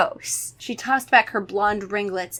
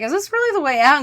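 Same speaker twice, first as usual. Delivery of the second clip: a hint of a higher, louder doubtful girl; doubtful Kara; 6 semitones higher, 5 dB louder, faster than usual. Kara doubting the way out, lightly voiced. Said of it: "Kara's like".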